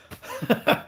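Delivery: brief unvoiced chuckle; a quick laugh to yourself; short laugh. Real, not acted. Streamer amused by his own holiday idea, short laugh.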